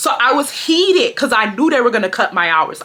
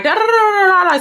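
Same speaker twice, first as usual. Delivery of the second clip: gibberish Spanish; 10 semitones higher, 4 dB louder than usual. Retold or imitated.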